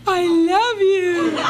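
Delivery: slow, high, loud love-struck swoon through a laugh. Retold or imitated. Swooning at the man quoting the Wolfman movie, slow and high. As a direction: melt over the Wolfman quote. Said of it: "And I was like this".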